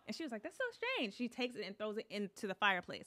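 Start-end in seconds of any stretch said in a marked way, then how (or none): 0.4-1.2 high, soft imitation of the lady; imitating puzzled lady. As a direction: quote her lightly, way up high. Quoting the lady's puzzled remark.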